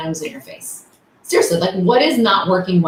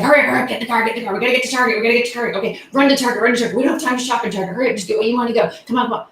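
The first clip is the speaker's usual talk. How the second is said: higher-pitched, breathless-sounding rush of orders; frantic rushing; 5 semitones higher, much faster than usual. Retold or imitated. Harried mom hurrying her daughter to Target, frantic rushing. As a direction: imitate a harried parent rattling off orders. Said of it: "the pace was killing me".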